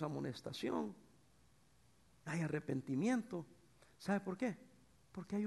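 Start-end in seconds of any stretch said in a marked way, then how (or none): none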